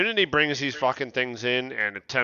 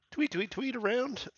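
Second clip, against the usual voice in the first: high tweeting; 10 semitones higher, 6 dB quieter than usual. Acted. Making the chick tweet.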